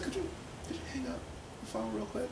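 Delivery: high, soft imitation of an aunt; aunt's careful request. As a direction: ask lightly in a high, quiet voice. Aunt's interrupting request, acted.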